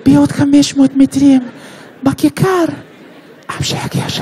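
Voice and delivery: put-on high Russian-accented navigation voice; Russian woman's voice. Navigation app giving directions, sultry Russian-accented voice. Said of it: "Waze עם קול של רוסייה".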